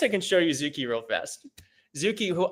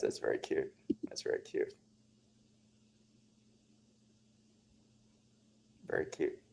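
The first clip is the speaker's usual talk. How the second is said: soft whisper; 13 semitones lower, 15 dB quieter than usual. Real, not acted. Reacting softly to a viewer comment.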